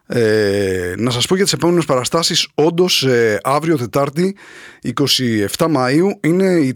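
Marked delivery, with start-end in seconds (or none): none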